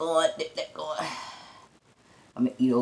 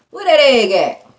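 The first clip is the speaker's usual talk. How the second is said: louder and higher, hunting for the egg; eager question; 7 semitones higher, 9 dB louder than usual. Real, not acted.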